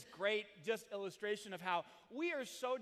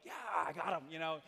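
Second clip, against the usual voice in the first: excited dog; 2 semitones lower, 5 dB louder than usual. Retold or imitated. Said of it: "he's all excited".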